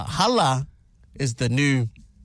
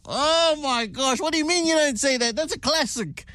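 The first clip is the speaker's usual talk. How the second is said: much higher, hammed-up disbelief; mock outrage; 10 semitones higher than usual. Dad in mock shock at his son dropping Jack. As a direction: gasp in exaggerated shock, pitch way up.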